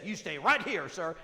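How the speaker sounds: Brenda's voice. Retold or imitated. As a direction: scoff at the husband in her voice. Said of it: "She said".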